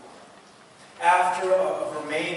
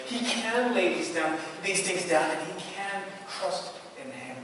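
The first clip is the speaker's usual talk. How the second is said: normal talking voice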